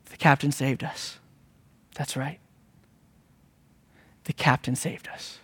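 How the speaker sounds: very soft and low; quiet, low, solemn admission; hushed reverence. Real, not acted. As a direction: let the answer drop almost to a whisper. Hushed answer to his own question.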